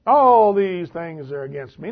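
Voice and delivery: loud, drawn-out lament; mock tearful lament. Preacher voicing Jacob complaining to his sons, mock lament. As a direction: stretch it out like a self-pitying complaint. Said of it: "Now he cries".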